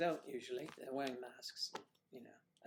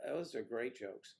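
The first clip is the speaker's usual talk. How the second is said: ordinary talk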